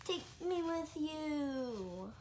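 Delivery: slow, drawn-out plea to the sky; pleading to aliens. Acted. Kid begs the aliens to take him, slow plea. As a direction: call up to the spaceship, slowly. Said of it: "make sure the aliens know I'm on their side".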